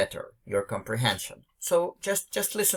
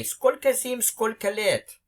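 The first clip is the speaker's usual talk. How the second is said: «Сколько зим, сколько лет?» exclaimed much higher, like greeting a long-lost friend; joyful greeting; 9 semitones higher than usual. Shown as a demonstration. Teacher showing how you greet a Russian friend, glad and exclaimed. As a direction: call out «Сколько зим» gladly, pitch jumping up on «Сколько». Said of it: "and you say".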